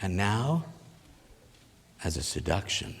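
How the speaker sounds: low, soft, teasing seduction; seductive. Shown as a demonstration. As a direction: drop down low and quiet, enticingly. Models the seduction asked of audience.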